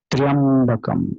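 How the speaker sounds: "Tryambakam" with a clipped half "tra", no full vowel. Shown as a demonstration. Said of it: "half tra is there, not full tra".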